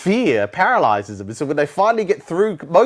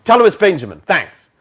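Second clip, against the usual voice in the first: brisk cut-off; 3 semitones higher, 9 dB louder than usual. Retold or imitated. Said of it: "I cut them off and say".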